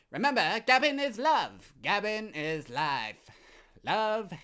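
very high, loud squeaky salesman voice; hammed-up falsetto Gavin; high-pitched shopkeeper. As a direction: play a manic cheerful shop owner. Voicing shopkeeper Gavin dialogue.

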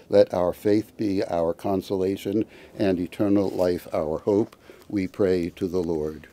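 normal talking voice

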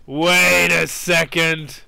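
stretched out, wary and slow; slow wary wait a second; slow suspicious. Real, not acted. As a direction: drag it, sensing a trap. Suspects a trap.

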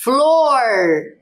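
FLOR read slowly, first sounds held, vowel stretched; sounding out. Shown as a demonstration. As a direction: read FLOR aloud for children, drawn out. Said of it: "Vamos ler?". (Flor lido devagar, esticando os sons)

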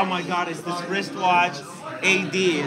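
normal talking voice

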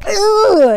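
loud, high groan of overwhelm; frustrated groan. Retold or imitated. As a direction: let out a big exasperated ugh. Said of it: "you wanna just go".

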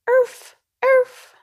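high, loud little puppy yapping; puppy barks. Acted. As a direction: yip like a tiny buried puppy. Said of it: "The mail was barking".

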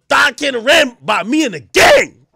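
loud hyped shouting of the video title; porn title announcer. Retold or imitated. Reading out favorite video titles, in a loud hyped shout. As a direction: announce it like a hype caption. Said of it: "that's how we search it".

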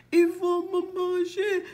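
high, anxious inner cry; frightened. Acted. Boucle d'Or fears the bears, frightened aside. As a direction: fret in a high, scared voice. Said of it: "elle a peur".

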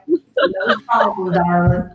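slow, drawn-out Southern accent on "no problem, darling"; a laughing Southern belle drawl; playful Tennessee accent, slowed way down. Shown as a demonstration. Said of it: "I can talk, like, sound like".

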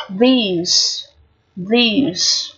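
"These" opened with a voiced, buzzing TH; tongue behind teeth. Shown as a demonstration. Teacher contrasts the second TH for learners, slowly modelled. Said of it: "phát âm là lưỡi sau răng".

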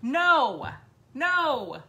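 emphatic, punched disgust; disgusted refusal. Retold or imitated. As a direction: reject it firmly, one hard word at a time.

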